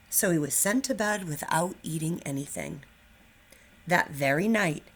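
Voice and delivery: lively picture-book read-aloud; animated storybook narration; reading to children. A narrator's reading. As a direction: read it like storytime for first graders. Teacher reads picture book aloud.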